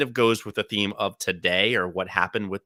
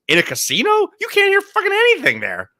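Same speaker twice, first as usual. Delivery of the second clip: mom's voice pitched way up, indignant; mother's voice; 4 dB louder than usual. Retold or imitated. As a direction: squeak it out like an exasperated mom. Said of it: "She goes".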